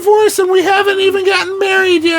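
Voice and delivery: high-pitched, melodramatic wailing; mock inconsolable partner. Imitating partner threatening to end it all, mock inconsolable wail. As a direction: wail it as an over-the-top tirade. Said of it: "she's inconsolable".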